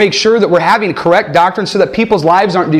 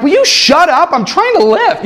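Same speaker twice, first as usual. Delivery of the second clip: annoyed retort; 10 semitones higher than usual. Retold or imitated. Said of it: "I'm like".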